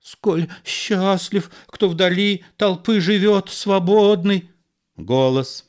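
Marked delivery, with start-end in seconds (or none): 0.0-4.5 higher, hesitant theatrical line; uncertain. Acted. Frightened actor resumes his role under threat, hesitant. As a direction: recite it unsure of yourself. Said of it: "Монфлюри неуверенно".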